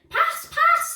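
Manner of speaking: very high, loud scream; high, loud, urgent scream. A narrator's reading. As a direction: scream for the ball. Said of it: "screamed Al".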